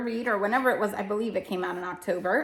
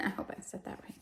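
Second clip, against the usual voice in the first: whispered aside; 13 dB quieter, faster than usual. Real, not acted. Unsure aside after hard name.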